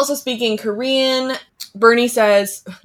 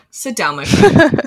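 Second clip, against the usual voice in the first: condescending Jack; 5 dB louder, slower than usual. Retold or imitated. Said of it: "I'm like".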